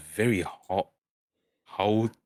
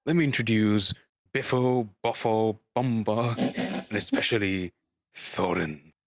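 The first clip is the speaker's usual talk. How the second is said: Gandalf's voice; 2 semitones higher, 3 dB louder than usual. Acted. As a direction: boom out the introductions like a grand old wizard. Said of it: "That's a Gandalf".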